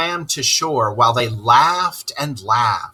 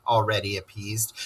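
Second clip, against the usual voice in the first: plain reading voice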